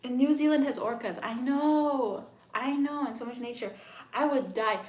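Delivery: high, loud excited gushing; thrilled. Real, not acted. Streamer thrilled that New Zealand has orcas. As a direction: squeal about the orcas.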